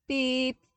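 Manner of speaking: sharp high electronic beep; insistent datapad beep; imitated beep. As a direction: chirp it like a datapad alarm. Datapad alarm repeats.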